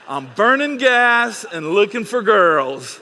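loud laughing retelling of the quote; laughing while repeating. Retold or imitated. Pastor laughing as he repeats the teen's line. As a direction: crack up while quoting the teen.

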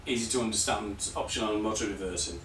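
strained, fading voice; losing voice. Real, not acted. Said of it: "losing my voice".

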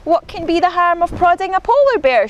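high, quick innocent question; mischievous wondering. A narrator's reading. Izzy plots her next mischief, mock innocent. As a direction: float a naughty idea innocently.

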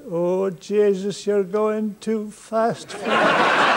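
slow Irish heckle. Retold or imitated. Heckler from the back.

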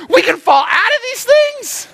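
very high-pitched, incredulous squawk; mock horrified disbelief. Novice rafter learns of the danger, hammed-up horror. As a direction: blurt it as a comically alarmed question. Shocked reply to guide.

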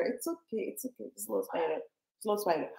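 soft, hushed reassurance. Real, not acted. Calming her child about spider.

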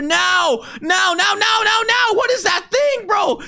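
high frantic yell; panicked shouting. Real, not acted. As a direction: shout in panic while fleeing. Fleeing monster, shouting.

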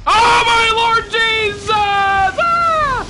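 high, drawn-out shriek of fright; real panicked screaming at a jump scare; terrified scream. Real, not acted. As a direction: scream it like something just lunged at you. Jump scare in horror game.

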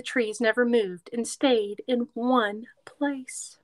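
lively storybook read-aloud; reading to children. A narrator's reading. Teacher reading a picture book to kids, lively read-aloud.

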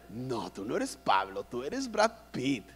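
low, slow flattering tone; butter up the boyfriend; syrupy mock compliment; fawning mother.

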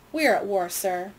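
ordinary delivery, nothing marked

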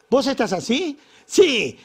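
high, loud accusing snap; snapping wife. Retold or imitated. Wife snapping at husband eating pizza, loud and high. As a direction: jump down his throat with a shrill question.

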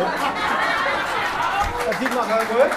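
slow, high, proud announcement; playful boast. Real, not acted. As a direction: draw it out with mock pride. Joke about coming prepared.

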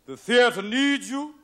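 slow, loud, grand pronouncement; John Houseman. Acted. Drama teacher's last words to students, slow and grandly declaimed. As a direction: declaim it with stately, drawn-out weight. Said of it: "John Houseman, his last words ... when he said".

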